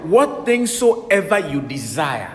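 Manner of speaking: slow, loud, weighty scripture reading; intoned scripture. Acted. Preacher stressing the key verse to the church, slow and loud. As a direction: stretch every word of the verse.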